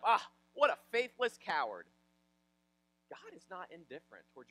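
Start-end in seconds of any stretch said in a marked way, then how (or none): 0.0-1.9 loud, higher-pitched scornful outburst; mock exasperation. Throwing his hands up at her suffering, mock exasperation. Mocking a response Jesus didn't give.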